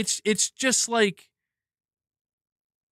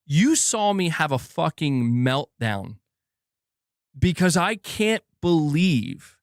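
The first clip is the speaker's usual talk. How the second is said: usual speaking voice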